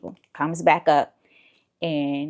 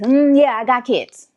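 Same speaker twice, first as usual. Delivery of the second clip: annoyed; 6 semitones higher, 8 dB louder than usual. Retold or imitated. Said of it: "I'm getting annoyed".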